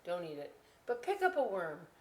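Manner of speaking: normal talking voice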